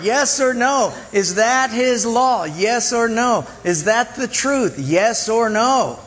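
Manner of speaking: slow, emphatic yes or no; preacher pressing the congregation for an answer; insistent questions. Real, not acted. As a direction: demand an answer each time. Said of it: "preaching rhetorical questions".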